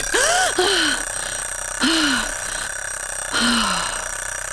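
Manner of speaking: a long high gasp that falls away; long startled gasp. Acted. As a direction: gasp at the blinding flash. Blinding flash explodes on stage.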